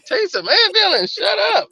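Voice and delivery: high-pitched mock disbelief; incredulous echo. Boyfriend repeating his girlfriend's dismissal back, high and incredulous. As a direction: throw the order back as an appalled question.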